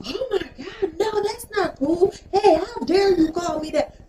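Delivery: high, slow, whiny mock outrage; mock offended whine. Rappers take offence at a slur: high, slow mock whine. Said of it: "was like".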